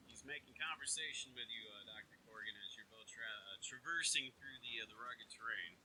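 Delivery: ordinary talk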